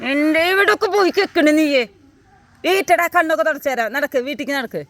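high, loud telling-off; scolding. Real, not acted. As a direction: order a wayward pet home. Scolding dog, ordering it home. (ഉറക്കെയുള്ള ശകാരം)

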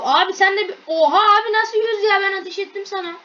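loud, high-pitched protest; outraged disbelief. Real, not acted. Player protesting that his shot should have counted, outraged disbelief. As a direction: burst out in disbelief at an unfair hit.

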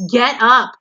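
very loud, urgent yell; screamed order. A narrator's reading. Wilbur screams at the lounging rat. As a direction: yell at him to move. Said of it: "screamed Wilbur".

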